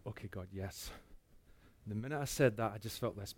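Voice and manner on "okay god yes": quiet surrender. Retold or imitated.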